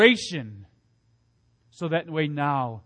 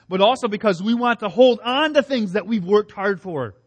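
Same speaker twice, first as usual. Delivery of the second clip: ordinary delivery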